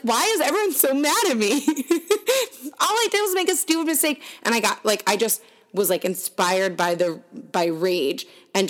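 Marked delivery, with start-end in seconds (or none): 0.0-4.2 high, whiny protest, pitched way up; exasperated whine. Retold or imitated. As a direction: plead like a wronged teenager, voice climbing high. Upset quote to parents.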